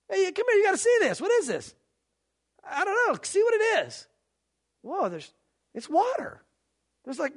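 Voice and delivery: high, startled voices of townsfolk; surprised onlookers. Retold or imitated. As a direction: gasp at rain you've never seen. Townsfolk seeing first rain.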